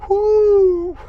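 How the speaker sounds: a sudden, very high, loud whoop; retold scream. Reliving finding his dog, a high, loud scream. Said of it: "I just started screaming".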